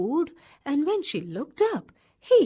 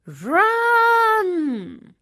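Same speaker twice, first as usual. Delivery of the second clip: higher, louder scream; 8 semitones higher, 8 dB louder than usual. A narrator's reading. Kangaroo screams to the wombat to flee, higher and louder. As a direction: scream a warning. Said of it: "she screamed to the wombat".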